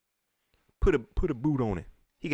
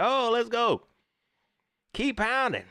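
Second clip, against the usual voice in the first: mock cheering; 11 semitones higher, slower than usual.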